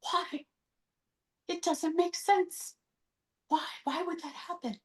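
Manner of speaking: hushed, high, near tears; grieving families. Retold or imitated. Voicing families left behind after a suicide, tearful and hushed. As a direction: voice the lost families pleading softly. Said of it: "Sorry, I'm getting really emotional".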